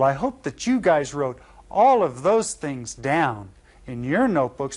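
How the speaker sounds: usual speaking voice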